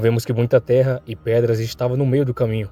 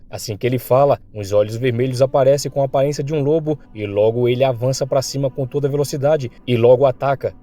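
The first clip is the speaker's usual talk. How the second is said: plain reading voice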